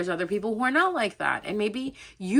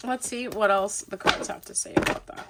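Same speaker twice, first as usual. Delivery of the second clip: normal talking voice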